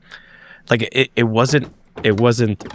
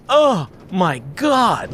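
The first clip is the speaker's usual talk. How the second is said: higher, slow gasp of shocked excitement; excited shock; 7 semitones higher, slower than usual. Retold or imitated. Friend watching the craft overhead, a high, slow shocked gasp. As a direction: let it out stunned and thrilled, drawn out. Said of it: "who was all excited, but also in shock".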